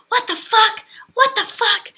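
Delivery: high, loud panicked swearing; startled yell. Retold or imitated. Tyler jumping up from a bee, loud startled yelling. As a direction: yell it like a bee just appeared.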